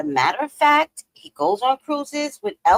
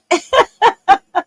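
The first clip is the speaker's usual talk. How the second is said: laughter; 8 semitones higher, 8 dB louder than usual. Real, not acted. Still laughing at the guys.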